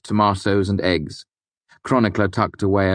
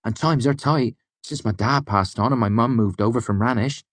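put-on higher, eager country-boy voice; a lightly raised young man's voice; young smith's prentice; 6 semitones higher than usual. A narrator's reading. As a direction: speak as an eager young lad. The boy's voice.

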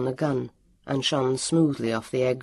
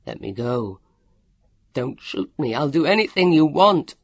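pleading, building from muted to a loud far-off yell; yelled plea; 5 dB louder than usual. A narrator's reading. Leon begging for his life, yelling. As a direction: start contained, burst into a loud desperate shout. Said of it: "Leon yelled".

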